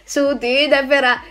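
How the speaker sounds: normal talking voice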